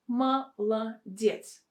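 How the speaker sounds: slow "Молодец", ma - la - DYETS; syllable-by-syllable demo. Shown as a demonstration. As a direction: split "Молодец" into syllables, unstressed O's as A. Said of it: "two O are not stressed".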